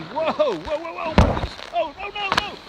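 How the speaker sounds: alarmed yelps as the tree sways; startled cry. Real, not acted. Startled by wind up palm tree.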